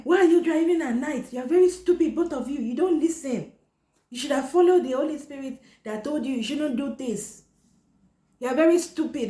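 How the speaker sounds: high, loud shrieking mother's yell; screeching angry mom. Retold or imitated. Mother yelling at daughter over the crashed car, high furious shriek. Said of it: "She's like".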